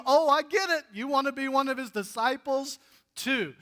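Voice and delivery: loud, high mock realization; saucy sarcasm. Blind man taunting the leaders with loud mock surprise. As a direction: play the fake lightbulb moment. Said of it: "he's so saucy in this moment".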